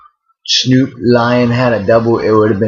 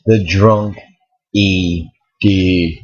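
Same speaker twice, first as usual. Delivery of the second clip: drawn-out title; 3 semitones lower, much slower than usual. Acted. Echoing the show title.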